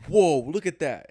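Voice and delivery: slow, loud impressed whoa; amazed whoa. Retold or imitated. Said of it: "I was like".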